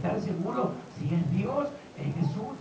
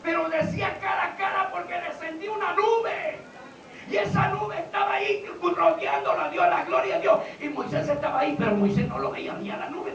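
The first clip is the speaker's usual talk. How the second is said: loud, raised sermon voice, easing at the end; forceful preaching; 8 semitones higher, 8 dB louder than usual. Acted. Preacher explaining Moses and the cloud, forceful proclamation. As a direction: proclaim it emphatically to the congregation.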